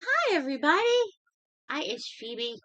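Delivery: very high, loud squeaky dog voice; voicing the dog. Owner voicing her dog Phoebe greeting the viewers, high squeaky voice. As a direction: speak for the puppy as if it greets viewers.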